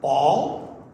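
single words with a rising lilt and pauses; name each thing for a baby, word by word; lightly acted parent teaching first words. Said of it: "as parents, say".

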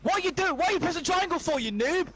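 high, loud, rapid telling-off; heated gamer outburst; exasperated shout. Real, not acted. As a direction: shout in disbelief at a blunder. Berates self or player mid-game.

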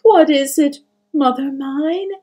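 worried son, voice lifted; nervous. A narrator's reading. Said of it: "he asked nervously".

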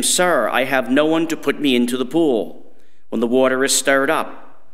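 usual speaking voice

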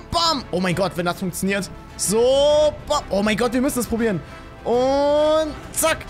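ordinary talk, nothing marked

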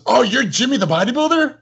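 high, astonished fan voice; amazed customer. Retold or imitated. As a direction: squeal with disbelief. Customer recognizes him, astonished.

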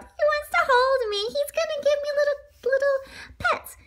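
very high, cutesy little voice; delighted donut character. A narrator's reading. Donut expects to be petted, high delighted voice. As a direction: coo it as a tiny pleased donut.

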